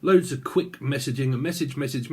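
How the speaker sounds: ordinary talk, nothing marked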